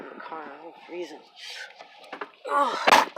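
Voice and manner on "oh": usual speaking voice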